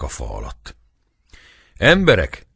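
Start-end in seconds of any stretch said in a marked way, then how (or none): 1.8-2.5 much higher, louder call. A narrator's reading. Priest calling the angry crowd to stop, loud. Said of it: "szólalt meg ekkor a pap".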